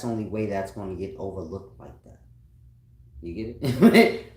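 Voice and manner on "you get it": loud, slow mocking "You get it?" with a rising end; loud taunt. Real, not acted. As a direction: shout it slowly at them, daring. Taunting the viewer.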